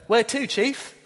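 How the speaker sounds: a hint of a taxi driver; lightly voiced cabbie donkey. Donkey politely asking its rider where to, cabbie voice.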